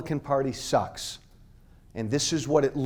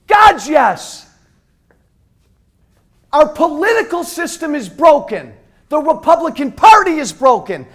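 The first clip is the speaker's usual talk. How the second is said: high, loud, angry yelling; furious shout; 9 semitones higher, 10 dB louder than usual. Real, not acted. Speaker shouting at the crowd about the Republican Party, furious. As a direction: rail against the party at full voice.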